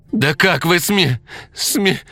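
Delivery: outraged, voice breaking off. A narrator's reading. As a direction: choke on the word in anger. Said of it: "Женщина повысила голос, запнулась".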